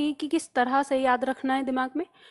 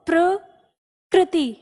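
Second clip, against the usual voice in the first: प्रकृति stretched out slowly for writing; slow spelling-out voice; slow dictation; 3 semitones higher, much slower than usual. Shown as a demonstration. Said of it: "ये रहता है".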